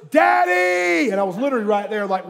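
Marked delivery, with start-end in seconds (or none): 0.1-1.1 put-on higher, louder call of a child; kid calling out. Son calling his father from the TV, loud. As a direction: yell for dad from the basement.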